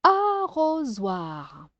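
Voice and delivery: "Arrosoir" slow and stretched, without breaks; drawn-out word. Shown as a demonstration. Teacher presenting a word for a child, "Arrosoir" drawn out. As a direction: hold each vowel of "Arrosoir". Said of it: "de faire les syllabes".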